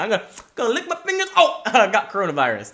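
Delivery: fast, high mock old-man voice; impression of elderly guy. Old guy licking his fingers and catching coronavirus, hurried elderly voice. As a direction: rush it in a comic old-man voice. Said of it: "that's my impression of that guy".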